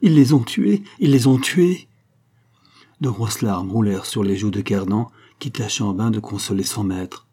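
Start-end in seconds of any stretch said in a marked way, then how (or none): 0.0-1.9 loud, high-pitched anguish, building; desperate cry. A narrator's reading. Count believes his family killed, anguished outcry. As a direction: wail it out in despair. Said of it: "Il était en proie au plus affreux désespoir".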